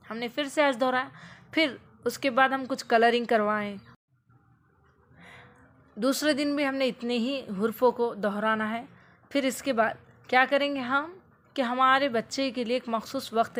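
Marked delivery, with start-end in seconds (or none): none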